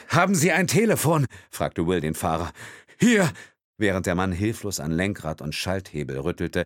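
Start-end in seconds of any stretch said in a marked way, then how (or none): 0.1-1.3 high, loud urgent ask; urgent question. A narrator's reading. Will urgently asks the driver for a phone. 3.0-3.3 high, loud hand-over; quick call. A narrator's reading. Driver shouts as he hands over phone.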